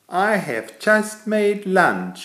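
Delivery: high, slow, sweet little mole lady; a mole invites the cat to lunch, high and slow. Acted. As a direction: put on a small high-pitched mole voice. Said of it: "she said".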